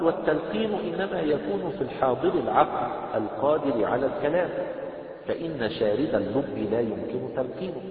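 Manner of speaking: slow, loud, measured recitation of the book; formal text reading. Acted. Teacher reading the book text aloud to the class, slow formal recitation.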